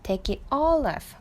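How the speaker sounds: all punched in a slow read of Take it all off; teacher showing where the stress falls. Shown as a demonstration. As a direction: hit the word all, slowly. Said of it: "강세 여기 있는 거".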